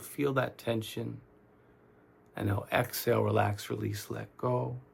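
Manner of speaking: soft, unhurried meditation guide with long pauses; calm, quiet guiding voice; guided meditation. Real, not acted. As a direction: talk them gently through tensing and letting go, leaving space between phrases. Leads guided relaxation meditation.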